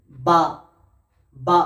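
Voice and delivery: বা said twice, lips pressed together; slow careful letter drill; Arabic letter demo. Shown as a demonstration. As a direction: model বা for students with both lips. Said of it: "বা উচ্চারণ হয় দুই ঠোঁটের".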